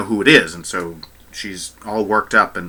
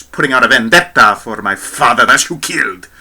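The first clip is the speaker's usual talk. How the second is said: a hint of a Spanish mobster, a bit louder; mock mobster accent on "vendetta"; Spanish mob accent; 5 dB louder than usual. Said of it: "that was like some Spanish mob guy".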